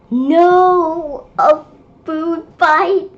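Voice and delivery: high, loud gasp of disbelief; shocked cry. Real, not acted. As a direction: react in real shock. Shock at food fight.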